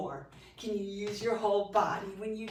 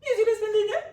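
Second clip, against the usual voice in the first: much higher and louder, squeaky; 10 semitones higher, 9 dB louder, much faster than usual. Shown as a demonstration. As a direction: squeak it up high and loud. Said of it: "Or in a high voice".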